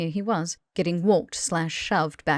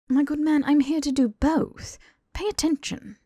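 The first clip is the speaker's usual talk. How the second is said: higher, pompous lecturer voice; pompous, higher lecturing Draco; lofty Draco; 9 semitones higher than usual. Acted. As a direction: talk down like a schoolmaster.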